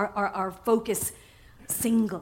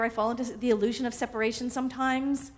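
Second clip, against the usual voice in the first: ordinary talk, nothing marked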